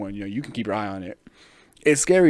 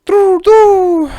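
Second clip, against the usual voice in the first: very high, loud falsetto coo; pigeon coo; 16 dB louder than usual. Retold or imitated. Pigeon mugger dismissing the begging tourist, loud falsetto coo. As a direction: coo at him like a mocking pigeon. Pigeon gang answers the victim.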